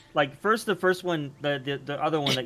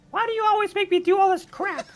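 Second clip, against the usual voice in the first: much higher, put-upon squeak; tiny whiny creature voice. Acted. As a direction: complain like a tiny bossed-around pet. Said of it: "this little voice".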